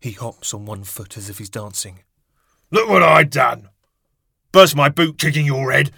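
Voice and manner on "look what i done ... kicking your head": furious yell; angry shout. A narrator's reading. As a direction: roar at him in rage. Father bellowing in story.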